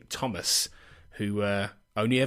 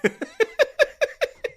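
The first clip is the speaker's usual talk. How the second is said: high laugh; 4 dB louder than usual. Real, not acted. Laughing at own joke.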